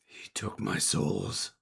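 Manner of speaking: slow monster grievance; aggrieved creature. Acted. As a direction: drag it out like a wronged beast. Creature answers why it is mad.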